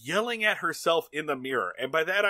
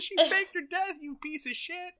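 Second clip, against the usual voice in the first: high, put-on berating voice; mock rant; 14 semitones higher than usual. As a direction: scold them in a squeaky strange voice. Mirror Genie berates Brady Bunch.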